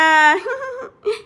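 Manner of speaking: high eerie cackle; witch cackle. Acted. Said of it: "এবার আসবে আসল মজা".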